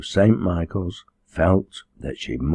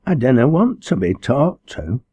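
high, plaintive dialect whine; whimpering; 6 semitones higher, 3 dB louder than usual. Acted. Troubled girl refusing the minister's talk, whimpering. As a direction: whimper it in a raised, girlish voice. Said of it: "she whimpered".

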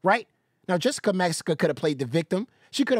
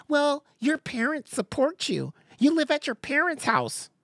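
higher, slow, condescending mock; high mocking imitation; 9 semitones higher than usual. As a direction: mimic her smug jab, pitched up. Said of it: "she said".

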